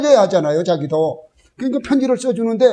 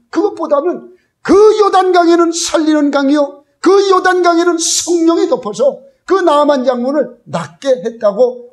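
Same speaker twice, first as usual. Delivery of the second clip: raised, high-pitched pulpit proclamation; forceful preaching; 7 semitones higher, 4 dB louder than usual. Real, not acted. Preacher proclaiming the Spirit over the Jordan, forceful preaching. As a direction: drive the point home to the congregation.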